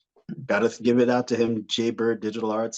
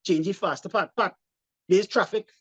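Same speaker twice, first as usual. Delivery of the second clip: nagging mom; 7 semitones higher than usual. Retold or imitated. Mom nagging dad changing a tire, mildly raised voice. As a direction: hurry him along, nagging from the roadside. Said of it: "She's standing there watching, going".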